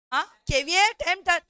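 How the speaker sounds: caveat emptor drawn out slowly and loud; slow stressed term. Shown as a demonstration. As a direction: spell out "caveat emptor" slowly and loudly for the class. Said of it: "teacher stresses the doctrine". ("caveat emptor" को धीरे-धीरे, ज़ोर देकर बोलना)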